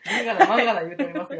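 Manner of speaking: fast, loud laughing aside. Real, not acted. Co-host cracking up at the Kansai joke, laughing loudly. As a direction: laugh through it, rushing.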